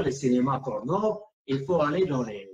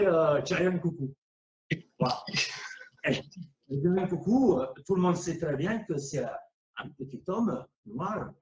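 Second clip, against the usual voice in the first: normal talking voice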